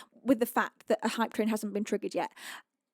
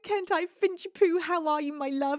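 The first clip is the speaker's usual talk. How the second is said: ordinary talk, nothing marked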